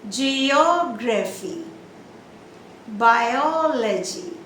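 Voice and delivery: very slow Geography, Biology, long OG and OL; extended stressed syllable. Shown as a demonstration. Teacher extends for you to understand, long O in Geography, Biology. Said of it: "I'm extending just for you".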